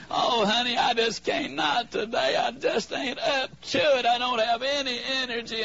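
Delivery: hammed-up exhausted begging; pleading whine.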